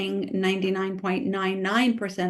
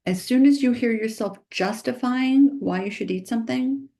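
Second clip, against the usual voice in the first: ordinary talk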